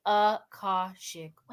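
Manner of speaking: Akashic said slowly as uh-KAH-shik; slow teaching pronunciation. Shown as a demonstration. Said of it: "Everybody repeat after me".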